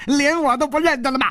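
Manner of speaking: put-on high, fast cackling old eccentric; crying out. A narrator's reading. Dwarf challenging Hu Tiehua, crying out in a high voice. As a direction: squawk it out after a cackle. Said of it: "矮子已经叫起来了".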